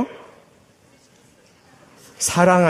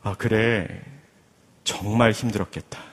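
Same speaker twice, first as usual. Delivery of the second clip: sympathetic husband; 8 semitones lower, 7 dB quieter than usual. Acted. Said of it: "이렇게 반응을 하는 겁니다".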